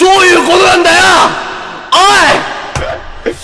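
high, loud outraged yell; outraged shout. Acted. Mock outrage at listeners.

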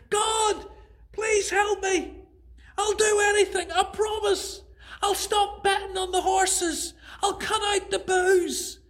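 high, loud frantic begging; desperate pleading. Acted. Jack begs God to save him, frantic. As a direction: promise anything in a panic.

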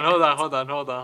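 normal talking voice

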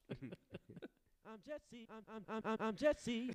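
very high stuttered name drop; put-on drop. Replaying the drop for laughs, high-pitched and stuttering. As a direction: repeat it like a stuttering jingle, way up high.

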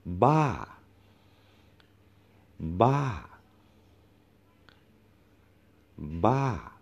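Ba climbs high and then drops sharply; Thai falling tone. Shown as a demonstration. Teacher shows students the falling tone, peaked Ba. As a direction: arch Ba up like a mountain and let it fall. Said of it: "the mountain or falling tone".

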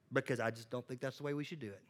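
soft, fast, sheepish; timid mumble. Pretend. Student sheepishly justifies himself to the professor, soft and fast. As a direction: mumble the excuse quickly.

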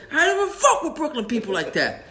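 high-pitched comic indignation; mock outrage. Stomping character rejects Brooklyn people, in hammed-up outrage. Acted skit quarrel, stomping again.

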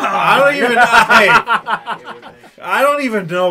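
loud, high laughing protest. Real, not acted. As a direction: laugh off the teasing. Laughing off the teasing.

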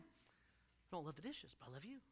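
husband muttering to wife, sheepish and soft; sheepish mumble. Retold or imitated. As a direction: mumble it under your breath to your wife. Quietly saying it to wife.

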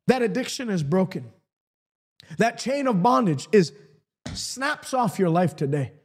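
ordinary talk

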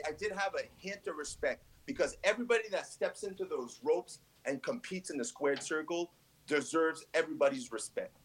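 ordinary delivery

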